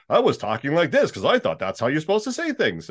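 pushed, put-on announcer read; beginner's announcer voice. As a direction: imitate a green voice actor overdoing it. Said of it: "when I first started".

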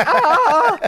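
a high, loud, hammed-up shriek of panic; mock crowd scream. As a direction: imitate the whole room screaming. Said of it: "everyone's like".

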